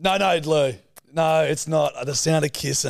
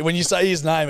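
normal talking voice

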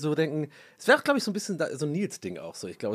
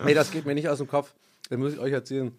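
ordinary talk, nothing marked

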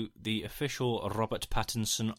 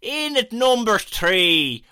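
very high, loud, slow mock Irish accent; hammed-up Irish accent; 11 semitones higher, 10 dB louder, much slower than usual. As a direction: announce it like a stage Irishman. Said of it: "I've gone Irish now".

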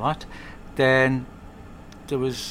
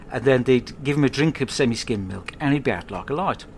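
ordinary delivery

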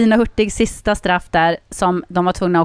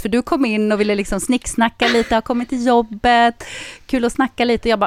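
normal talking voice

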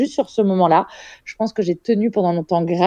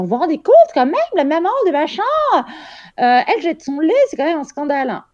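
high, scandalised gossip voice; mock outrage shared in the corridor; pitched-up indignant whisper-to-colleagues tone; gossiping nurse; 10 semitones higher than usual. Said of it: "en disant".

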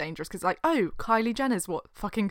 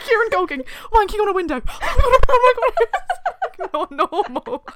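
high loud laughing squeal; laughing shriek; 12 semitones higher, 8 dB louder than usual. Real, not acted.

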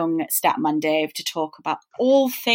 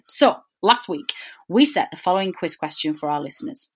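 ordinary talk

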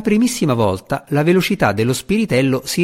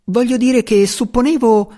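much higher sprite voice, mumbled excuse; squeaky stammer; 11 semitones higher, 4 dB louder than usual. A narrator's reading. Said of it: "farfugliò Tefanis".

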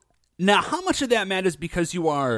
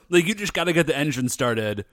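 ordinary talk, nothing marked

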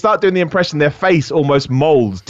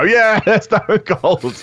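slow, drawn-out Stone Cold growl with a laugh; laughing Stone Cold imitation; mock Stone Cold; 6 semitones higher, much slower than usual. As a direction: drag out a lazy Stone Cold yeah. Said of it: "you do Stone Cold and we all go".

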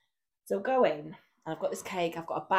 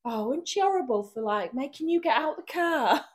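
put-on higher, louder, slow pointed baby talk; loud mock sympathy; 7 semitones higher, 8 dB louder than usual. To her crying baby, loud pointed mock sympathy. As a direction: pity the baby so she overhears. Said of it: "loud enough that she can probably hear me".

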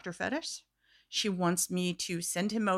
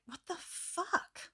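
slow, breathless whispered what the fuck; whispered disbelief; 9 semitones higher, 11 dB quieter, much slower than usual. Retold or imitated. Waiting for the woman's reply, slow whisper. Retold reaction to silence.

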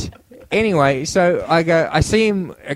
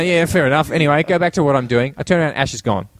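ordinary delivery

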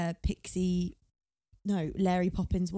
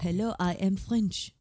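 slow mock French accent; slower than usual. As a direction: imitate a bad French accent, drawn out. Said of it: "had to do a French accent".

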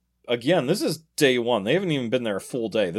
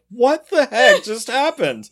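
high, slow bewildered question; stunned wife trying to make sense of it; slow, high-pitched disbelief; baffled; 12 semitones higher, 7 dB louder than usual. Retold or imitated. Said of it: "Kathy's just standing there like".

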